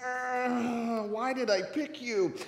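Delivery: long exasperated groan into the question; mock exasperation. Jesus to his clueless disciples, exasperated. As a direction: groan as if fed up with slow pupils.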